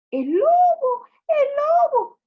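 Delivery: very high, loud wolf cry; shouted alarm. Acted. Shepherd sees the real wolf, shouting. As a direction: yell for rescue, vowels held. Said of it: "gritó el pastor".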